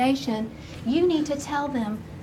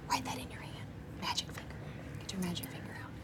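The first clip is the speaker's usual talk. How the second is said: hushed, whispered instructions; 4 semitones lower, 17 dB quieter, faster than usual. Real, not acted. Teacher sets children writing in their hands, whispering.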